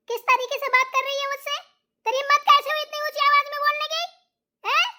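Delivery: how dare you, fired fast; indignant rebuke. Acted. Mother-in-law furiously rebukes the daughter-in-law, fast and loud.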